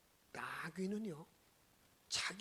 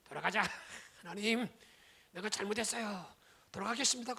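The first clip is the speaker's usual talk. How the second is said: loud earnest repentance; 9 dB louder than usual. Acted. Repentant plea to God.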